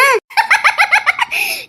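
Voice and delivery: high burst of laughter; giggling. Acted. Laughs when boy is named.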